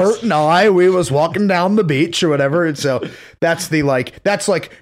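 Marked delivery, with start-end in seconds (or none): 0.0-2.6 put-on Maine accent.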